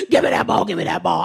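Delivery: put-on low, rough demanding toddler; grandbaby aggressively demanding the ball. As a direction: bark it like an angry toddler. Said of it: "he was like".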